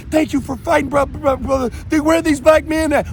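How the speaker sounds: voicing the women who thank him and ask questions; imitating grateful women.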